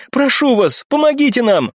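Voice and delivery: high, urgent plea; pleading. A narrator's reading. Brunette begging the captain for rescue, pleading. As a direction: beg for help.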